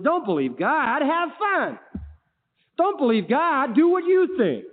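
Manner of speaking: high, swooping tempter's call; hammed-up devilish coaxing; Satan tempting. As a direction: egg them on with mock glee. Acts out Satan tempting people.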